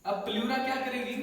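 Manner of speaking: ordinary delivery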